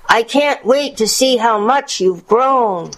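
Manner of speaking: high, slow granny voice; put-on old-lady coaxing; wolf posing as grandmother. A narrator's reading. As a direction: lure the child in, slowly and high.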